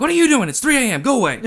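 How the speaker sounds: high, loud indignant outburst; startled yelling back. Retold or imitated. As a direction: shout to drive the stranger off. Retells yelling back at hiker.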